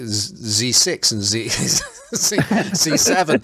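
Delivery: mock German accent saying Z; hammed-up German accent, high pitch; bad German accent. Shown as a demonstration. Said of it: "it just sounds like I'm doing a bad German accent".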